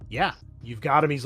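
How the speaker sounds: normal talking voice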